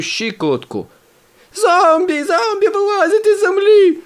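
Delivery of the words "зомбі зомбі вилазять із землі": very high, loud panicked screaming; terrified shriek. A narrator's reading. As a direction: shriek in panic as something grabs the ankle. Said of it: "верещу я".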